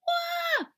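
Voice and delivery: a squealing high cry; high wail. Acted. Burglar slipping on the ice wails high. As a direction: wail high like a cartoon fall.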